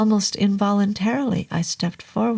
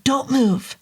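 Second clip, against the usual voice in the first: snapped command; 6 semitones higher than usual. Acted. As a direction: snap the order. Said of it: "George Thousand Names snapped".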